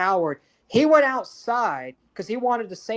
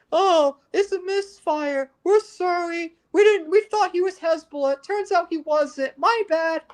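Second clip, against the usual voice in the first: mocking fake apology; 8 semitones higher than usual. Mocking Israel's excuse for killing the priest, a high put-on apology. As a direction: sneer out a careless sorry.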